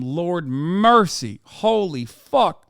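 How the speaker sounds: normal talking voice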